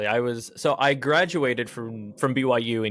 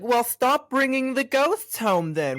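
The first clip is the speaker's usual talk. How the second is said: sass it like a New York mom; 13 semitones higher than usual. Retold or imitated. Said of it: "Like that New York mom is like".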